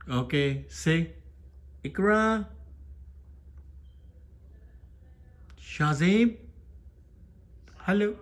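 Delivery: high-pitched pet-training call; coaxing a pet. Real, not acted. Prompting pet to repeat words.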